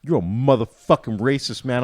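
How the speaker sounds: high, loud accusation; accusing vendor. Retold or imitated. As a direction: call him out. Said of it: "And he's like".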